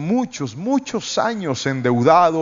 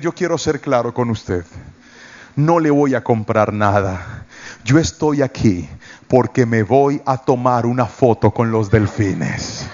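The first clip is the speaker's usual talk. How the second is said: firm refusal; 6 semitones lower than usual. Retold or imitated. Pastor refusing the salesman, low and firm. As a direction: turn the salesman down flatly. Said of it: "Yo lo miré a los ojos y le dije".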